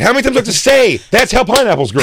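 high, loud exasperated chef yell. Retold or imitated. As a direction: bark at him like a fed-up chef. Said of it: "stop yelling at me like you've been working".

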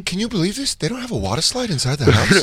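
mock incredulous homeowner. Friend plays rich buyer complaining to Nate, mock incredulous. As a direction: play a rich man appalled at his house.

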